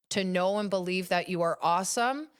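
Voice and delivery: ordinary delivery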